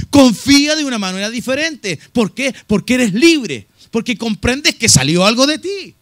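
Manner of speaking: preaching. Real, not acted. Preaching climax.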